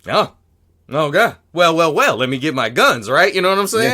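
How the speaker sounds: excited mock challenge; hyped up. Real, not acted. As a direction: gear up for a showdown with relish. Mock bracing for a fight.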